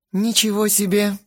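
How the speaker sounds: high surprised gasp of a line; amazed exclamation. Acted. Girl seeing the couple asleep, high and amazed. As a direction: blurt it out, amazed. Girls see naked sleeping Lena.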